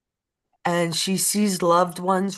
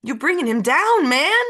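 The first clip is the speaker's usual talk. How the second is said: higher and louder, scolding the mourners; real scolding, much higher and louder; 7 semitones higher, 5 dB louder than usual. Real, not acted. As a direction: push it out high and loud at them.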